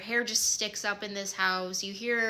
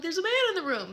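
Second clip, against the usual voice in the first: high-pitched mock panicked cry; retold screaming; 9 semitones higher, much faster than usual. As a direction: imitate a frightened shriek without full volume. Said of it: "And I'm screaming".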